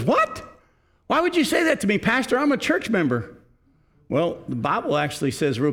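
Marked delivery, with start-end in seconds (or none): 0.0-3.4 much higher, an outraged squeak; shocked indignation. Retold or imitated. Church lady shocked at her pastor, imitated high outrage. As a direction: retell her affronted disbelief.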